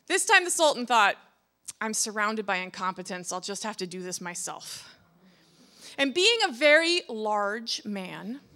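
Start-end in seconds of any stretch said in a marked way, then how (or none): 1.8-4.9 low, softening grumble; resigned muttering. Acted. Sultan fed up with his guards, resigned grumble. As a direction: grumble it to yourself.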